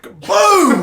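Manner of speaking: high, loud crash sound with a laugh; loud laughing boom at the moment of impact. Retold or imitated. Crash into the armadillo.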